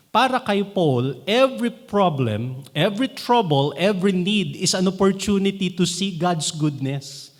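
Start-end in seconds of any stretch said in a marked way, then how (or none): none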